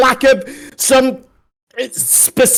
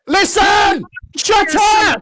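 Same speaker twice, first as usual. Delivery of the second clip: angry shout; 8 semitones higher, slower than usual. Real, not acted. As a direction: bark it out to silence him. Shouting down an interrupter.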